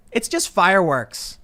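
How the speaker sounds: high, loud, drawn-out mock quote; quoted camera person. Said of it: "the camera person that Vice sent out is like".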